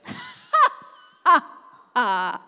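loud, high mock-evil ha ha; sinister little laugh. Mother voicing her son's triumphant gate laugh, hammed-up. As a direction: cackle like a smug toddler villain. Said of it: "he goes".

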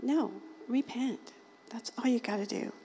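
ordinary delivery

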